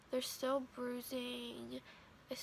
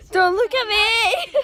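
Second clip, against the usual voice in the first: loud embarrassed laughing plea; 10 semitones higher, 18 dB louder, slower than usual. Real, not acted. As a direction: laugh it out, hiding. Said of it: "She's freaking out".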